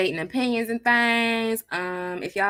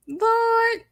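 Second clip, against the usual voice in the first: high Lord. Real, not acted. Reaction to baby news.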